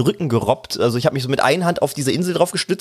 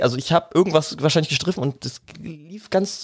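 ordinary delivery

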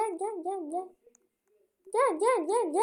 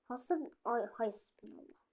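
whisper; 5 semitones lower, 11 dB quieter than usual. Real, not acted. Muttering aside about her voice.

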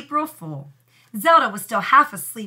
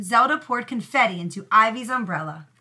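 plain reading voice

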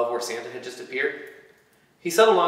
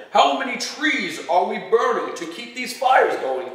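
higher, louder Santa bellow; 9 semitones higher, 5 dB louder than usual. A narrator's reading. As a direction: boom it across the factory floor. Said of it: "he bellowed".

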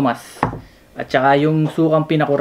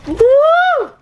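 excited; 6 dB louder than usual. Real, not acted. Cheering before tasting.

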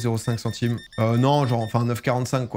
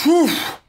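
pained grunt; 6 dB louder than usual. Real, not acted. Strains, then says he has aches.

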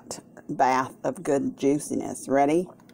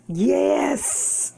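excited yes; 10 semitones higher than usual. Real, not acted. Turkey reveal, cheering.

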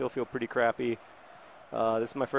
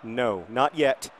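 usual speaking voice

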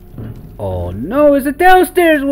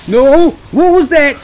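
scared shout; 8 semitones higher, 8 dB louder than usual. Real, not acted. Jump scare in horror game.

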